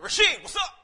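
loud, high mock challenge; cocky call-out. Preacher retells confronting the bully down the hall, cocky shout. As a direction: call him out with hammed-up swagger.

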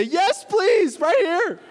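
high, loud, overjoyed yes please; a worn-out parent leaping at free babysitting, high and loud; eager parent shout. Acted. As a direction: jump at the offer like a worn-out parent. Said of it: "I need some parent to say".

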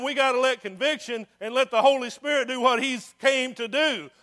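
loud, driving preacher cadence; full-force preaching. Acted. Preacher exhorting the church, loud and driving. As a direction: push it out hard to the congregation.

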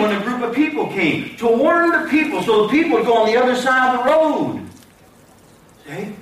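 ordinary talk, nothing marked

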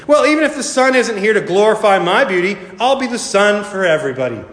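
high-pitched, self-admiring boast; vain Marigold. A narrator's reading. Marigold boasting of her beauty to the sisters, vain.

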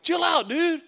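high, loud mock shout; jumping in harshly. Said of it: "if I'd have jumped in like".

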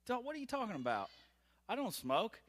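high, quick, disbelieving protest; baffled, brushing off a silly claim; incredulous denial. Retold or imitated. Dad protesting to his son, high and incredulous.

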